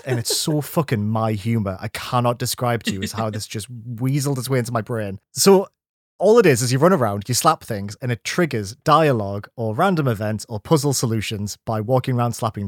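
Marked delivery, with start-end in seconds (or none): none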